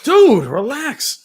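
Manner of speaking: scolding the cat. Real, not acted. Telling the cat to calm down.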